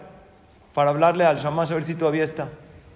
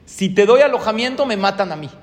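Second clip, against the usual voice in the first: loud, higher frightened refusal; fearful refusal; 4 semitones higher, 6 dB louder than usual. Retold or imitated. Old friend loudly refusing shelter to the hiding family. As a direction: push him away out of fear.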